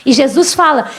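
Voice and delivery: ordinary talk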